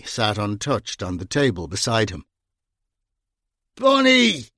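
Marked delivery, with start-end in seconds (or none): 3.8-4.5 put-on loud, high drunken roar; enthusiastic roar. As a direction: bellow the name with glee. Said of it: "he roared enthusiastically".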